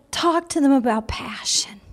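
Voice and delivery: hushed whisper of God's voice. Retold or imitated. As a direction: whisper it, as a secret word. God's voice retold.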